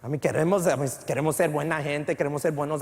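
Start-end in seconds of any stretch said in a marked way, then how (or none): none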